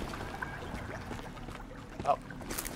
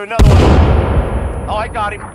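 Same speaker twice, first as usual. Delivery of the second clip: excited shout; 4 semitones higher, 14 dB louder, faster than usual. Real, not acted. Throwing grenades mid-fight.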